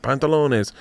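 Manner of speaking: put-on "Pantalones" in a playful Spanish accent, rolling and stretched; mock Spanish. Comic showing Spanish and Italian sound alike, "Pantalones" in mock Spanish. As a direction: say "Pantalones" like a Spanish word for trousers. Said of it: "a little bit like Italian and Spanish".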